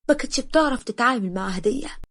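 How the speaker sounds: ordinary delivery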